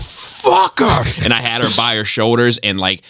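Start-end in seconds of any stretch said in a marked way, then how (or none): 0.0-1.2 huge high drawn-out yell; furious yell. Retold or imitated. Dad in the elevator yelling at his biting daughter, long high shout. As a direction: blow up at the kid all at once. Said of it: "I was like".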